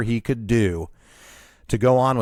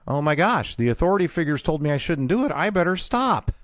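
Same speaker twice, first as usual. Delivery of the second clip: higher-pitched, mock-worried self-quote; obedient worrier; 6 semitones higher than usual. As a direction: play the rule-follower, lightly fretting. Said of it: "if that had been me".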